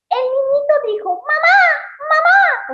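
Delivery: put-on very high, loud child calling; little boy voice. Shown as a demonstration. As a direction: cry out like a small boy. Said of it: "cuando empiecen a leer los personajes".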